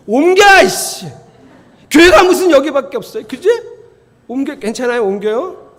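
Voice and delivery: loud, high huffing complaint; offended churchgoer. Retold or imitated. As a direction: storm off to another church.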